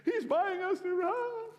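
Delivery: a tearful high cry of disbelief. Retold or imitated. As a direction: sob the good news out. Crying friend shouts the news.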